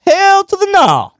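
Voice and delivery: high, drawn-out hell to the no; sassy flat refusal, stretched slow; emphatic refusal. Real, not acted.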